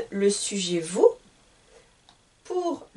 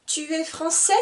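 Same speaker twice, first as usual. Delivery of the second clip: Tu es français? with the voice rising at the end; rising question; 5 semitones higher than usual. Shown as a demonstration. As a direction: lift the pitch on the last word, français. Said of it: "je vais monter la voix".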